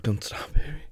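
airy pleading whisper; whisper, aroused; breathless whisper. Real, not acted. Aroused, unvoiced.

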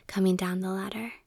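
ordinary delivery, nothing marked

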